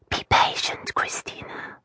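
soft whispered order. Acted. Mother hushing her fidgety toddler, gentle whisper. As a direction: breathe it out, barely there. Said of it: "Mary whispered".

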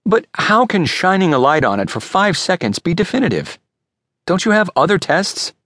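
high-pitched, disbelieving challenge; baffled, pressing objection; incredulous protest. Acted. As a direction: question the verdict, voice climbing. Buyer disputes the expert's verdict.

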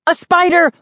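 high-pitched mock scream. A narrator's reading. Kid tricking sister mid-serve, mock scream. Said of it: "I screamed".

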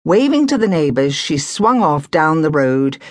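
ordinary delivery

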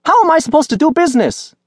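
put-on very high, loud outraged old woman; angry vendor shout. A narrator's reading. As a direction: shriek out the complaint. Fruit seller shouts angrily.

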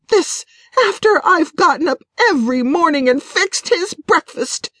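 indignant, high-pitched wife's outburst; temper flaring. A narrator's reading. Betrayed wife rants to her friend about her husband, temper flaring. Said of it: "Janet's temper flared".

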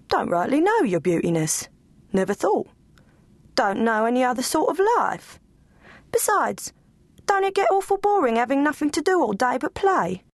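simple, honest hound talking; humble scruffy dog. Acted. Bones answering Lulu Bell, folksy and high.

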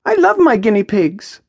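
high-pitched, earnest declaration; absolute conviction. A narrator's reading. Maya defends her pets to Jack, full conviction. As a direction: declare it with total certainty, high voice. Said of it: "says with absolute conviction".

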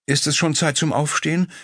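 drowsy wife just woken; sleepy husky good morning. A narrator's reading. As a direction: ask it half-asleep. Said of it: "wollte eine schläfrige Stimme wissen".

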